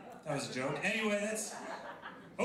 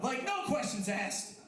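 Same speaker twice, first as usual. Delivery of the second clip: ordinary talk, nothing marked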